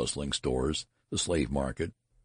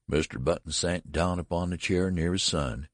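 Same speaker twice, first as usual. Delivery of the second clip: ordinary delivery, nothing marked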